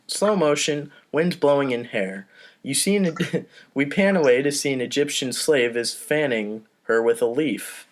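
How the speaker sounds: usual speaking voice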